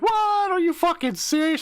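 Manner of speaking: shrieking what, are you serious; shocked disbelief. Retold or imitated. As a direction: squeal it in total disbelief. Guys shocked.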